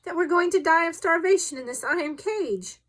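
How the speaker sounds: loud, high, agitated protest; irascible outburst. A narrator's reading. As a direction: cry out in angry exasperation. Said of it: "said our irascible companion".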